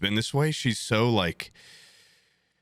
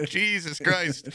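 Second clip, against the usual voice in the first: mild exasperated aside; 7 semitones higher, slower than usual. Retold or imitated. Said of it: "I'm like".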